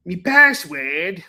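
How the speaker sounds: slow, drawn-out Australian "Me password?" asked with a rise; mock Australian accent. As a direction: stretch "Me password?" into a puzzled Aussie question. Echoing the Australian bit.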